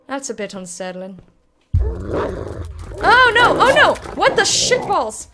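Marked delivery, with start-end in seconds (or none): 1.8-5.3 high, loud startled cry; alarmed shout. Real, not acted. Startled by the game, shouting in alarm. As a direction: yelp in shocked alarm.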